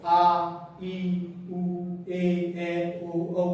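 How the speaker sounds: vowels held long, partly sung; आ, ई, उ, ए, ओ, औ each stretched and held. Shown as a demonstration. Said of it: "इनमें ज्यादा जोर लग रहा है".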